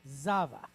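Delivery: Zavach with the za stressed. Shown as a demonstration. Teacher showing where the stress falls in Zavach. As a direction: hit the first syllable of Zavach. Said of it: "we're gonna stress that syllable".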